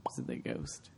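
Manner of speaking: hushed ghost-story whisper; spooky whisper. Pretend. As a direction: breathe it like a ghost tale.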